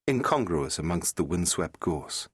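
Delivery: usual speaking voice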